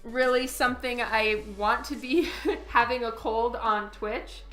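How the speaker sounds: ordinary talk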